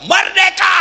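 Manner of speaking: loud, high cry of freedom; joyful mock shout of relief; triumphant shout. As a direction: yell it like a victory cheer. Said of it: "suami masuk kamar berteriak".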